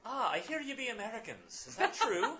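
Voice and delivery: high, loud Irish lilt through a laugh; hammed-up Irish with a chuckle; Irish accent. Newspaperman asks the Americans if it's true, Irish accent. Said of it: "can I do the accent right?".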